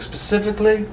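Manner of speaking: high-pitched questioning character voice; insistent Templar. Acted. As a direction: ask it way up high. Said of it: "He's like".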